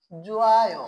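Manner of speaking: জুয়ায় with vowels gliding, no H; careful model of জুয়ায়, h silent; pronunciation model. Shown as a demonstration. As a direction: draw out জুয়ায় as one linked word. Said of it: "তাহলে উচ্চারণটা কি হবে?".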